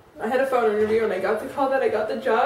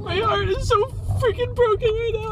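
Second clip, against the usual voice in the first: high, breaking voice, crying hard through the words; ugly cry; 6 semitones higher, 3 dB louder than usual. Real, not acted. Leaving home at dawn, crying to the camera, sobbing. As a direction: sob it out, heartbroken and barely holding together. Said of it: "my ugly cry".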